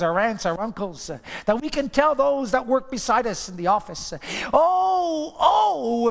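from slow plea to shouted oh; preaching build. Acted. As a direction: build the sermon to a cry.

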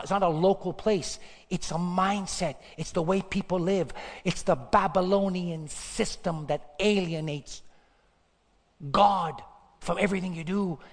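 ordinary delivery

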